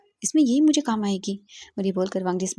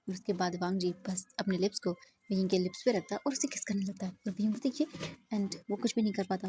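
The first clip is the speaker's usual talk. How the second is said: whispered narration; 9 dB quieter than usual. A narrator's reading. Narrating the kiss.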